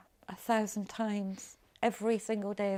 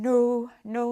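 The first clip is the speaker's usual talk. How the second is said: anguished denial; 6 semitones higher, 8 dB louder than usual. Retold or imitated. Denial after baby's death.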